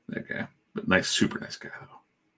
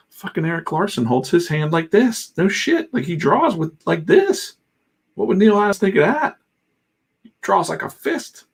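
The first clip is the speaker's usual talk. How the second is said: high-pitched, hammed-up disbelief; mock incredulous; 11 semitones higher, 4 dB louder than usual. Streamer shows how another artist holds his pencil, mock incredulous. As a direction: push it way up high, playfully aghast.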